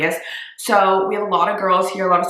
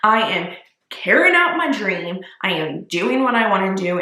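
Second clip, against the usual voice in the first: normal talking voice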